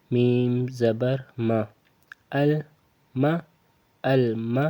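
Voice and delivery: normal talking voice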